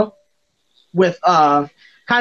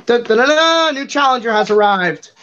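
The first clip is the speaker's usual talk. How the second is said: higher, sung fanfare into announcement; mock game announcer; 4 semitones higher than usual. Game master introducing a new challenger to players, mock announcer. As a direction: herald a new fighter's entrance.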